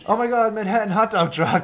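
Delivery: high, loud burst of delight; excited squeal. Real, not acted. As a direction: squeal it like a thrilled fan. Delighted at cute Snapchat filter.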